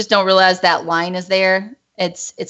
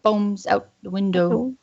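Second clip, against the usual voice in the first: bad Scottish imitation; 4 dB quieter, slower than usual. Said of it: "That was kinda bad".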